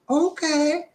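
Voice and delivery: high falsetto, prying aunt; high-pitched questioning auntie; nosy auntie. Acted. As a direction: quiz him in a high auntie voice. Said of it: "my auntie".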